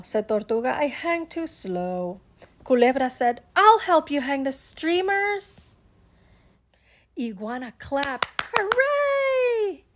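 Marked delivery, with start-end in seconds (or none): 0.7-2.2 slow, drawn-out turtle delivery; leisurely turtle drawl. Acted. 3.5-5.6 high, loud, eager volunteer; eager offer. Acted. Snake unexpectedly offers to help. 8.6-9.8 very high, sustained cheer; elated whoop; joyful cheer. Acted. Iguana claps at offer of help.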